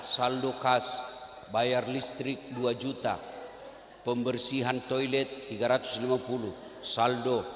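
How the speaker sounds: low, slow, monotone reading of the cash report; droning report reading. Retold or imitated. Worshippers mocking the endless cash report, low slow drone. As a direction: read the figures like a bored treasurer. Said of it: "dibaca lagi keadaan keuangan".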